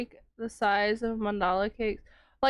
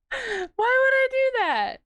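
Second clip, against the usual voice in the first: mock indignant squeal; 13 semitones higher, 4 dB louder than usual. Mock offended reply to chat.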